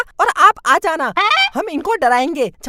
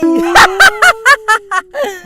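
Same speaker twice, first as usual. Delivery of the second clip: loud cackling laugh; hammed-up cackle; witch cackle; 2 semitones higher, 5 dB louder than usual.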